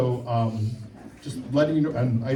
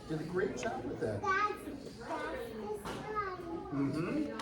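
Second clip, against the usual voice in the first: quiet praise; 7 semitones higher, 11 dB quieter, slower than usual. Real, not acted. Quietly praising kids' drawings aside.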